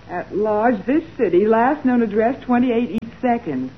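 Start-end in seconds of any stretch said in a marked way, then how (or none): none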